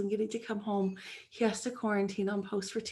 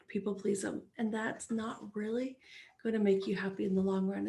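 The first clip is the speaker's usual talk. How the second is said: usual speaking voice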